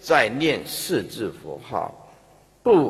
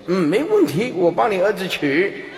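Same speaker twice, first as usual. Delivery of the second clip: loud, higher mock assurance; demonstrated hearty promise; 5 semitones higher, 5 dB louder than usual. Shown as a demonstration. Helper promising the dying parent anything, loud mock assurance. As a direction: agree instantly and emphatically. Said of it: "对死人要这样讲的".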